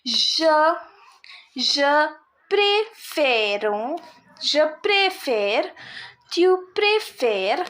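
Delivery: slow conjugation drill, each préfère sounded out; slow teacher's model of préfère, syllable by syllable; showing accent change. Shown as a demonstration. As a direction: stretch préfère with open è, préférons and préférez with closed é. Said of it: "you see the change of sound".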